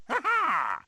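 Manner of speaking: a short gleeful "ha ha"; triumphant laugh. Acted. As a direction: laugh like you've got a trick up your sleeve.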